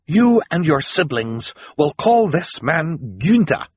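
high, loud, slow command; stern order. Acted. Esme glares at Violet, giving an angry order. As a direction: lay down the law angrily, word by word. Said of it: "she ordered".

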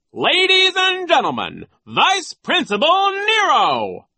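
very high, loud, grand self-introduction; pompous showman at full pitch; Nero announcing. A narrator's reading. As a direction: announce yourself like a star, stretching the name. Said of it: "he announced".

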